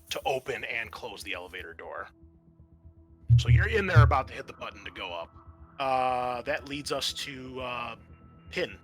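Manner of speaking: ordinary talk, nothing marked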